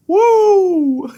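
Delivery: loud, high cheer; big excited woo for the opening match; excited whoop. Real, not acted. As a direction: let out a real woo of joy. Hyped by the big spot.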